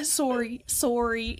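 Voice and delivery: soft "sorry" with a rounded "sore-ee" vowel; "sorry" rhyming with "story", softly; Canadian pronunciation. Shown as a demonstration. Demonstrates Canadian sorry after American.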